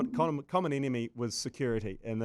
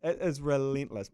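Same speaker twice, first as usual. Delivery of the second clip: ordinary delivery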